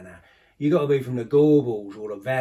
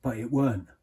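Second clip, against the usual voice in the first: usual speaking voice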